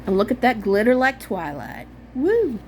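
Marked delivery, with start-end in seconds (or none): 2.1-2.6 excited whoop. Real, not acted. Excited about the glitter.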